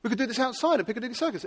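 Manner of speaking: normal talking voice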